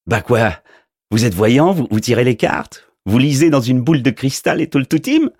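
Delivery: a goofy postman who can't believe it; incredulous mailman. A narrator's reading. Postman asking if the wizard does party shows, incredulous.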